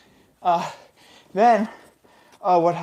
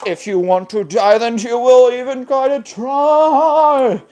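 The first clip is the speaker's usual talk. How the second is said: building to a loud, high, drawn-out finish; theatrical threat; 6 semitones higher, 5 dB louder than usual. Acted. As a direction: swell grandly on the last word. Darwin's threat, stretched and swelling.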